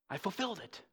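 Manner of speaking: very soft, understated claim; quiet, weighty confiding; hushed aside. Retold or imitated. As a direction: say it barely above a murmur. Jesus' quiet claim voiced by preacher.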